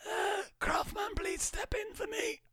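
very high, slow, strained caster cry; hammed-up high caster wail; impression of Tylacto. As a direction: squeal it like a panicked caster. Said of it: "That's who I heard there".

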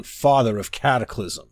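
ordinary delivery

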